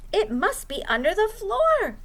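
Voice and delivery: owl working out where the noise is; lightly voiced owl. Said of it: "said Owl".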